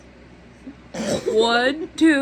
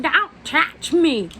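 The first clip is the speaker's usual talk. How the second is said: put-on high, slow whiny old lady; mock old lady; 7 semitones higher, 6 dB louder, much slower than usual. Imitating the old lady refusing help. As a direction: drag it out whining. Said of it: "my mom's like".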